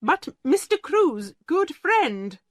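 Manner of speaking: clinging to a last hope; anxious headmistress. Acted. Headmistress grasping at the friend of Mister Crewe, high and anxious. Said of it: "began Miss Minchin".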